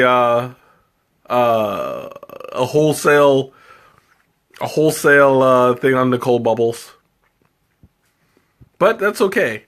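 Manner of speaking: ordinary delivery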